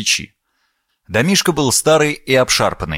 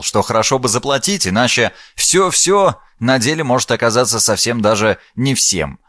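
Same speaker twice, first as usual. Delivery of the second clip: usual speaking voice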